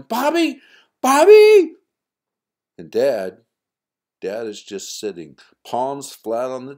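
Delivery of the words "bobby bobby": very high, very loud frantic yells; panicked yelling. Acted. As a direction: scream for your missing son. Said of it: "and then yelling".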